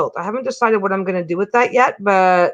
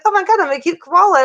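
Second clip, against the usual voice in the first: cute koala; 8 semitones higher, 4 dB louder than usual. Retold or imitated. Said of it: "He's like".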